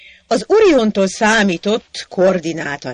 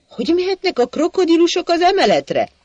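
much higher, fast bewildered question; baffled; 11 semitones higher, 3 dB louder, faster than usual. Acted. Hacsek baffled at crocodiles climbing to the upper floor. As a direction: sound utterly baffled.

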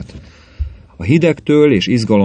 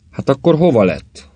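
higher, a touch louder, spooked outburst; shuddering snort; 9 semitones higher, 4 dB louder than usual. A narrator's reading. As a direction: burst out with a chill of dread. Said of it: "horkant fel Ripko úr hideglelősen". (borzongva, magasabb hangon felhorkanva)

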